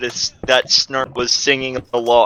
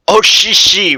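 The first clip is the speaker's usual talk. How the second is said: mild read-out shout; 6 semitones higher, 5 dB louder than usual. A narrator's reading. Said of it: "Raku-chan shouted".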